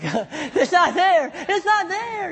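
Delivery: high, loud, laughing mock despair; laughing disbelief. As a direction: laugh at your own shock.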